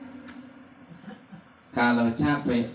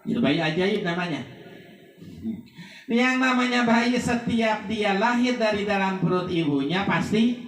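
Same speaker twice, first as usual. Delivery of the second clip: raised, emphatic sermon voice; fervent preaching; 10 semitones higher than usual. Acted. Preacher warning the funeral crowd, raised and emphatic. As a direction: drive the warning home to the mourners.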